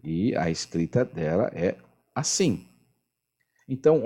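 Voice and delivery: ordinary talk, nothing marked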